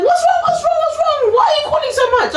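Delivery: high, mocking impatient nurse. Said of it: "she's like".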